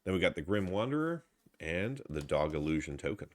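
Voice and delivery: ordinary talk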